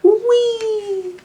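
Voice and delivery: very high squeal of delight; gleeful whee. Acted. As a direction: squeal it like a leaping bunny. Rabbit jumps high.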